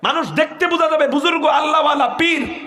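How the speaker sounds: high, half-laughing warning about the pious-looking man; imitating someone raising an alarm. Preacher voicing a warning to the crowd, high and excited. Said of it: "কয়".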